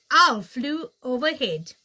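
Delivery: ordinary delivery, nothing marked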